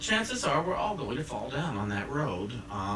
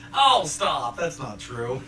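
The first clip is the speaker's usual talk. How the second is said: laughing it off; 7 dB louder, slower than usual. Pretend.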